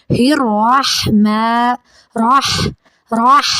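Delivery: الرحمن with the ح pushed out on the breath; breathy throat ha. Shown as a demonstration. Teacher shows the ha from the throat. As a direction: push the breath out on راہ. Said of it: "سانس باہر کی طرف آئے گی".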